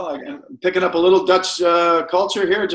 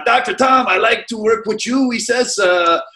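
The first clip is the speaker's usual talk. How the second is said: put-on light French Canadian accent, higher and louder; 6 semitones higher, 4 dB louder than usual. Realtor quoting Quebec seller's saying: mild French Canadian accent. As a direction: imitate a Quebec landlord's French Canadian lilt. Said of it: "in his good French Canadian accent".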